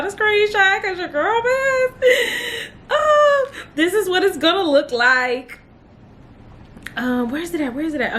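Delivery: high laughing cry of joy, real; laughing through tears. Real, not acted. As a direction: laugh and cry at once, overwhelmed with relief. Overjoyed showing her pass result.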